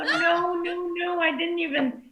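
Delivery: ordinary delivery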